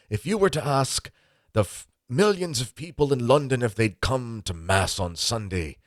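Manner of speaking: put-on an old Irish priest making his point from the pulpit; lightly held Irish sermon accent; Irish priest impression. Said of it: "I'll do my best Irish impression".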